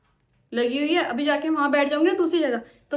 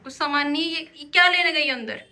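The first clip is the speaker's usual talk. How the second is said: normal talking voice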